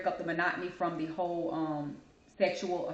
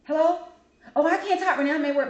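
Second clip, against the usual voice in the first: high, louder put-on phone voice; mock phone answerer; 7 semitones higher, 6 dB louder than usual. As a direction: play someone picking up while claiming they're busy. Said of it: "people that answer the phone".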